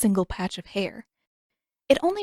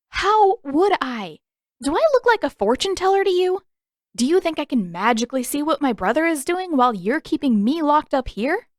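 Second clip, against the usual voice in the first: much higher and louder, sarcastic fury; exasperated rant; 7 semitones higher, 4 dB louder than usual. A narrator's reading. Katara ranting at the prince who keeps her locked up, furious. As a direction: rant at him in disbelief.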